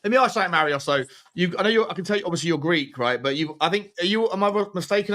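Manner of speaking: normal talking voice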